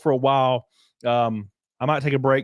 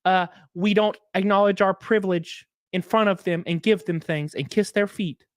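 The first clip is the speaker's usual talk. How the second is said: lightly voiced college woman, higher pitched; mock college woman; 5 semitones higher than usual. Mocking college women offended by the movie, mock-offended higher voice.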